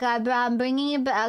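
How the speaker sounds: ordinary delivery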